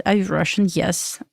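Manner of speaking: normal talking voice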